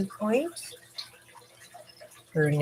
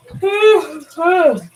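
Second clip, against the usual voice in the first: yawn sigh; 12 semitones higher, 8 dB louder than usual. Real, not acted. Tired streamer yawning on stream.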